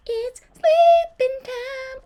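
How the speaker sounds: high sing-song. Shown as a demonstration. Streamer announces bedtime in the haunted house, high silly sing-song.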